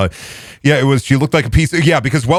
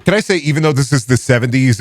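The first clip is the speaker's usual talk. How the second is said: normal talking voice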